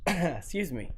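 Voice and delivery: coughing after wine went down wrong; throat-clearing between coughs; choking on a drink. Real, not acted. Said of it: "I'm choking on my last drink of wine".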